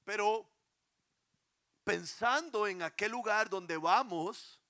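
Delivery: preacher rising to fervour; impassioned preaching. Acted. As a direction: lift the congregation with longing. Preaching new section.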